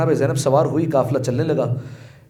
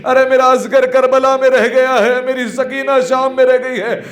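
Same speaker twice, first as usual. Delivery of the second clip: high, loud grieving wail in words; retold weeping lament; 11 semitones higher, 5 dB louder than usual. Rabab mourning her children to Zainab, wailing lament. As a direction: cry out as the bereaved mother, pitched high. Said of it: "جناب رباب نے رو کر کہا".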